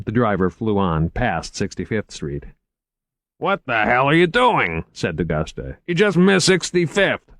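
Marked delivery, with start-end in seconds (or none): 3.4-7.4 raised, angry exasperation; a touch of a furious cop; exasperated detective. A narrator's reading. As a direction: snap at the lost driver.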